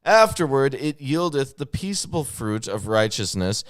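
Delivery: loud, emphatic King James declamation; dramatic scripture reading. Acted. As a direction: declaim the verses with stretched key words.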